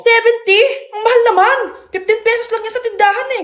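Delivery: high indignant complaint about the cost; shocked at price. Acted. Mother on the plane balking at the snack price, high indignant complaint.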